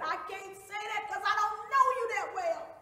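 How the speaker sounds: high, loud defiant cry; screamed refusal. Acted. Beaten woman refusing to say sir, screamed defiance. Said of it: "And she screamed".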